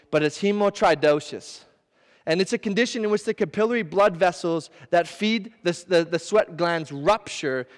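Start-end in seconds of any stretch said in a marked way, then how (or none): none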